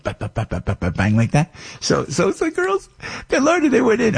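high, rapid ba ba ba bang; hammed-up noise of the girls storming in; mock charge noise. Imitating daughters charging in.

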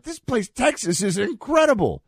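high, excited tourist gushing; lightly voiced awestruck tourist; quoting tourists. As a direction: gush like a delighted visitor. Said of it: "I won't do their accents".

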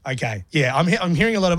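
usual speaking voice

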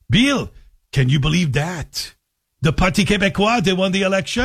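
put-on light Québécois-accented English, eager; a lightly done Quebec French accent delivering big news; mother-in-law's Quebec accent. Mother-in-law breaking election news to Bill, light Quebec accent. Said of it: "making fun of my mother-in-law's accent".

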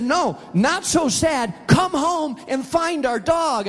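wife on the phone urging her husband home; upset wife. Retold or imitated. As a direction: sound like a worried wife pressing him. Wife scolding him on phone.

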